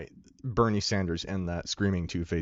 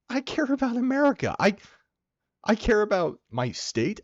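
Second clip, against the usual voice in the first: high-pitched, impassioned declaration; 13 semitones higher than usual. Real, not acted. Answering talk of bailing out Ukraine, high impassioned outburst. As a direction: burst out high with heartfelt insistence.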